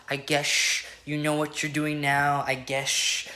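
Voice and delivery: slow, soft, whispery mock singer voice; mock her breathy inflection, slow and soft. Mocking [name]'s [name]-style inflection, whispery and soft. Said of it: "[name] copy with her vocal inflection where it's, like, kinda whispery and quiet".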